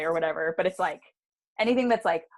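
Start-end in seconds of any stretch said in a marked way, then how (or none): none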